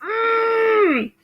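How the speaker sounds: high, strained sob on "Mm"; crying hum. Retold or imitated. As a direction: hold back a sob in a hum. Recalling crying at the movie.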